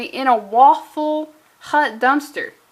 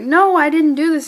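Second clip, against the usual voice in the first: mock denial; 5 semitones higher, 8 dB louder than usual. Voicing character's denial.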